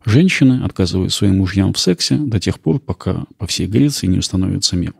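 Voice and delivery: ordinary talk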